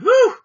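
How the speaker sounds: high joyful whoop; loud whoop. Real, not acted. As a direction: let out a big cheer. Cheers at end of questions.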